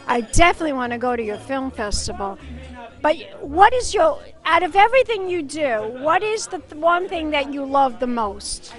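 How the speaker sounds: ordinary delivery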